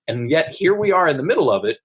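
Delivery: normal talking voice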